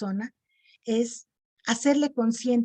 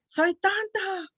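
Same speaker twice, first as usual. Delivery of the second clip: much higher, slow call; 9 semitones higher, slower than usual. Shown as a demonstration. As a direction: cry out soy tonto into the cave. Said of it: "o empieza a decir".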